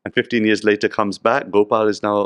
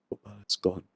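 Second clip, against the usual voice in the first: grieving widow; 11 dB quieter than usual. Retold or imitated. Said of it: "She looks at Narad Muni and says".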